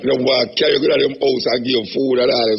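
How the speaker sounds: ordinary talk, nothing marked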